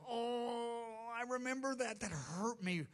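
Jesus tenderly consoling the persecuted, soft and high; tender empathy. Acted. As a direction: speak as one who shares their hurt. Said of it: "He'll be going".

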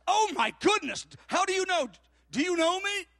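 loud, high mock astonishment; astonished. Waitress whose pain was guessed, astonished. Waitress amazed at being known.

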